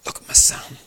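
"Look at my son" nearly whispered; hushed urging. Retold or imitated. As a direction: repeat "Look at my son" in a breathy hush. God's words, repeated hushed.

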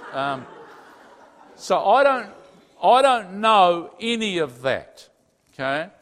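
ordinary delivery

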